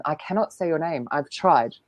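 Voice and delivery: ordinary delivery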